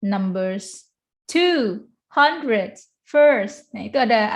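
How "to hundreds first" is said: to, hundreds, first each punched higher on its own; stressed number words. Shown as a demonstration. Teacher showing numbers carry stress, each of to, hundreds, first emphasised. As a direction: hit to, hundreds and first one by one, with pauses. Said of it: "ada stressnya".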